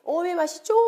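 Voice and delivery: exclaimed in Jeolla dialect. Shown as a demonstration. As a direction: sing out the Jeolla catchphrase. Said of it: "전라도 사투리로 쓰면".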